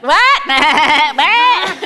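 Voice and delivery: high, loud mock bafflement; imitating kids not hearing; kids' loud what. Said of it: "And they're like".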